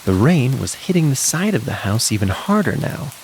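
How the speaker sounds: usual speaking voice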